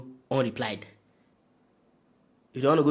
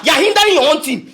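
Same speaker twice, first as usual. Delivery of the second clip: angry shout; 5 semitones higher, 5 dB louder than usual. Real, not acted. Angry rant at player.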